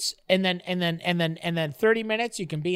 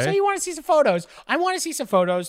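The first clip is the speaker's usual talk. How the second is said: high mocking voice; 7 semitones higher than usual. Mocking partner's question again.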